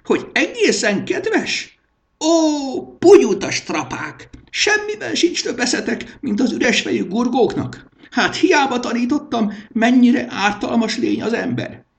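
very high, loud furious tirade; a high shrieking king scolding his servants; shrill rant. A narrator's reading. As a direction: rail at them in a screech. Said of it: "rikácsolás".

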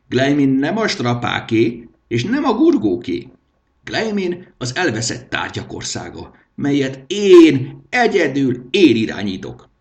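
high, loud proclamation of power; a shrill little tyrant addressing his subjects; haughty king. A narrator's reading. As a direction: lay down the law with wounded pride. King's speech to dismissed.

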